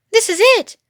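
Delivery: high, loud triumphant cry; Mister Fox crying out on finding the cellar; excited cry. Acted. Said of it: "cried Mister Fox".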